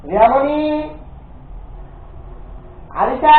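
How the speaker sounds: loud, high call with rising endings; high-pitched holler, each name lifting up. Real, not acted. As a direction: call names out to someone in another room. Calling the others over by name.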